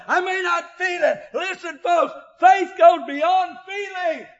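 loud exhortation with shouted peaks; fiery revival exhortation; full-force preaching. Acted. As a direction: urge the church on, calling out.

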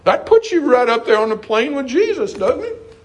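high, exalted pulpit delivery; stretched, ringing preacher's cadence; fervent preaching. Real, not acted. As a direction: lift it up high as a sermon climax.

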